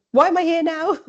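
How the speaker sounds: a hammed-up wail of why am I here; mock panic. Nervous runner asking a stranger at the start, mock panic.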